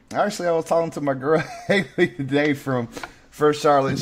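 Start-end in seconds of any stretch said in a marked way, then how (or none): none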